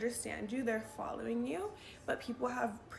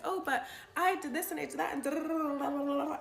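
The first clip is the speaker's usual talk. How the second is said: fast, higher mock chatter; mock rambling; 5 semitones higher, 5 dB louder than usual. Mocking her old self talking over men, fast and chattery. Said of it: "I'd be like".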